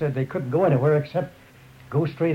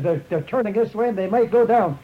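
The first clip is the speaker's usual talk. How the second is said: calling out; 6 semitones higher, 5 dB louder than usual. Retold or imitated. Cheyenne warning others.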